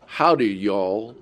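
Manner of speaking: low, slow Texas greeting; Texas accent. Pretend. Preacher imagines a Texan rising from the grave, light Texas accent. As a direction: put on a light Texas drawl. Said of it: "he uses his Texas accent".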